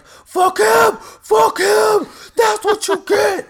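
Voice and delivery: very high, loud hollering; mock gloating yell. Imitating his friend heckling at the fight, gloating high shout. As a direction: heckle at full volume, way up high. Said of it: "L J's like".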